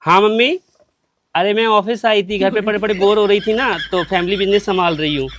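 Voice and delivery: normal talking voice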